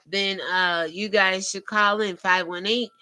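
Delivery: ordinary talk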